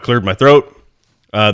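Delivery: ordinary talk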